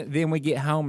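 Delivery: usual speaking voice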